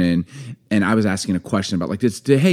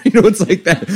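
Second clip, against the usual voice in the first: usual speaking voice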